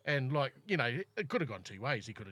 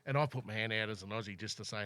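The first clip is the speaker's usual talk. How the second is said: ordinary talk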